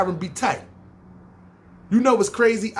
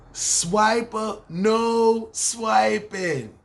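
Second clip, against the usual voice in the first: higher, very slow, stretched-out catchphrase; 4 semitones higher, much slower than usual. Pretend. Teasing the coworker who swipes cards, in a stretched-out cartoon catchphrase. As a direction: drag out every word of the cartoon line, pitched up. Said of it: "teasing the card swiper again".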